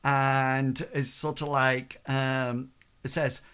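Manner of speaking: ordinary talk, nothing marked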